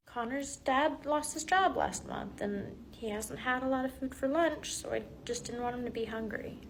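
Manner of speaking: very soft, subdued; hushed, sincere admission; reluctant confession. Acted. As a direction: reveal it gently and quietly. Student reveals friend's hardship.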